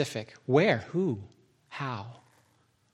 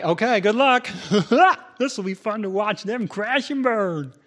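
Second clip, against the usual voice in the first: higher-pitched cackling mock well-wisher; silly voice, laughing as it wishes them luck; put-on gleeful voice; 6 semitones higher than usual. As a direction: gloat playfully in an odd put-on voice. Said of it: "he would have that accent anyway or that voice".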